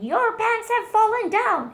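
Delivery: high, loud shocked scream; lady screams. A narrator's reading. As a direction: shriek at the old mouse's pants. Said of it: "the lady screamed".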